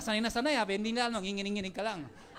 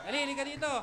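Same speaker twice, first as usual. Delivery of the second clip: calling out; 3 semitones higher, much faster than usual. Real, not acted. Calling Anna Marie to the front.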